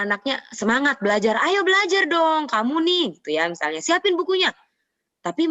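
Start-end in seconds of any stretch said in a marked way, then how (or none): 1.4-3.0 nagging. Acted. Parent motivating a child who hears it as scolding, nagging. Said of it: "kayak marah-marah gitu". 3.8-4.5 high-pitched bossy parent order; nagging. Acted. Parent telling a child to get books ready, nagging. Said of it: "kayak marah-marah gitu".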